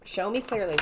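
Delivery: ordinary talk, nothing marked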